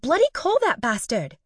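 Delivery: high, loud eager cheer; cheering shout. A narrator's reading. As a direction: egg her on like a cheerleader. Allie cheering her friend on.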